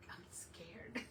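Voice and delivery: breathy little whisper, voice dropped right out; scared whisper. Retold or imitated. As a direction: whisper it like a secret fear. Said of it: "but I'm like".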